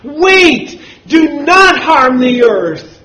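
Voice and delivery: high, loud shouted order; an angel calling an urgent halt; loud angelic command. Acted. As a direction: cry out each command as a separate burst. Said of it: "with a loud voice, this angel says".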